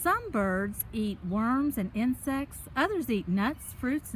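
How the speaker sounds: usual speaking voice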